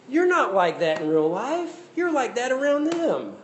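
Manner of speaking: high, slow mock accusation; calling people out. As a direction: call out a two-faced friend. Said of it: "Be like".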